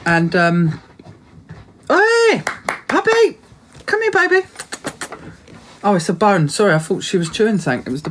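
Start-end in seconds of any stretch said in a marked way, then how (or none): 1.9-4.5 high, sing-song coo to the puppy; much higher doggy voice. Real, not acted. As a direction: call the dog in a sweet high voice. Talking to her dog.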